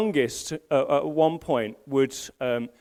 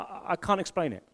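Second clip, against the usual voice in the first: ordinary talk, nothing marked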